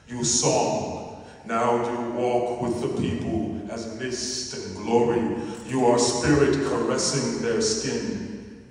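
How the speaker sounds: impassioned poem recital. Acted. Poet invoking martyred Gaza writers to the gathering, impassioned recital. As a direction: call each name out and lift the lines like a hymn.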